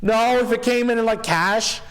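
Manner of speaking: high sing-song pitch of a lowball offer; bad offer delivery. Shown as a demonstration. Agent showing how to deliver a bad offer, high and airy. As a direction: float it up high, stretched out. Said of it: "how you deliver that bad offer".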